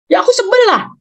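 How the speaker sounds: put-on high sulky child voice; sulky teen. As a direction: whine it like a fed-up kid. Anak menjawab, suara anak. (anak cemberut dengan suara tinggi)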